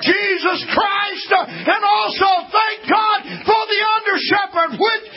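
high, shouted preaching with a cry in the voice; whooping preacher at the peak of the sermon; full-force preaching. Acted.

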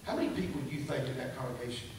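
low, quiet questioning voice; hushed, lightly voiced divine rebuke; the Lord. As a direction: drop low and soft for a searching question. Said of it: "I heard the Lord speak to me".

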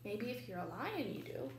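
plain reading voice

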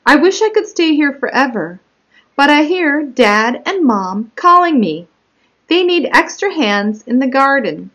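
lifted, expressive storybook narration; picture-book read-aloud. Acted. Child narrator called away to the garden, read as animated story narration. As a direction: read to children with swooping emphasis.

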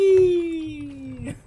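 high gleeful cheer; gleeful whee. Real, not acted. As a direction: let out a playful whee. Sliding down zipline, cheering.

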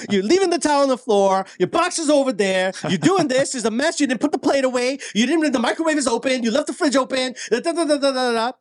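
hammed-up nagging spouse; nagging wife. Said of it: "what my wife says to me".

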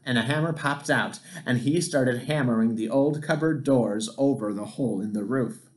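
ordinary delivery

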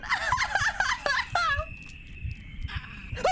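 high mad cackle; villain laugh in the game; evil cackle. Acted. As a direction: cackle like the wicked mother. Game mother laughs madly.

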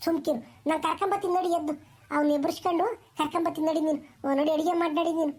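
rattled off fast; hurried. Acted. Grandmother shooing the mother off to cook, fast hurried talk. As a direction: brush her off quickly.

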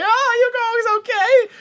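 high hammed-up calling; mock call. Hero drifting past henchmen, high mock call. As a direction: sing it out high after them.

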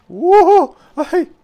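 very high, loud whoop, then short yelp; high-pitched cry of sudden alarm; startled yelp. Real, not acted. Player caught out while exploring, startled high yelp.

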